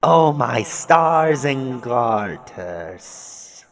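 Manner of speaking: very slow, stretched-out exclamation; stunned dismay. Real, not acted. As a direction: draw it out in shocked disbelief. Reader reacting to shocking scene.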